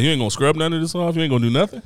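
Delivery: ordinary talk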